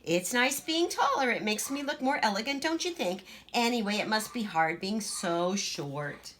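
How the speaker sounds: put-on high, bossy little girl voice; Greta, boastful child. Big sister boasting to Gracie, high and bossy. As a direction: show off being taller.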